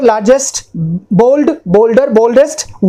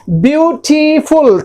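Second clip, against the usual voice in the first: "Beautiful" stretched as byu-ti-ful; syllable by syllable; 3 semitones higher, slower than usual. Shown as a demonstration. Teacher showing students three syllables, each one drawn out. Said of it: "तीन हो गए ना".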